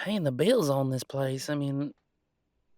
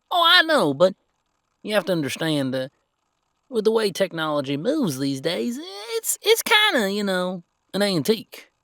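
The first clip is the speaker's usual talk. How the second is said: higher and louder, eager to defend; excited; 6 semitones higher, 5 dB louder than usual. Acted. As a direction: jump in quickly, almost squeaky. Defending the old computer.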